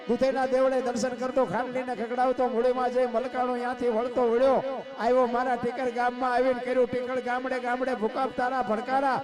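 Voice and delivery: loud, high-pitched chanted folk verse; rousing poem recital. Acted. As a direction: belt out the verses on a high, driving, level pitch. Recites a folk poem about the earthquake.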